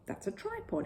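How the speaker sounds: a touch of bear pride; proudly. A narrator's reading. Paddington showing off his tripod, proudly. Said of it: "explained Paddington proudly".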